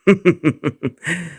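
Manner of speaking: high scheming laugh rising at the end; sinister laugh. Acted. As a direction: laugh as if savoring a secret plan. Villain before revealing his plan.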